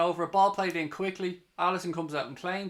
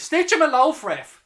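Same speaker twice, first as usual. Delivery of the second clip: angry shout; 10 semitones higher, 11 dB louder than usual. Real, not acted. Fan yelling at referee.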